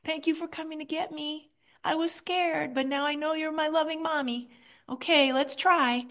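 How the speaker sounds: put-on high, soft small-child voice; little child's voice. Inner child answering the loving parent, small and soft. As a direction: speak as a shy little kid. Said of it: "hear a little voice say".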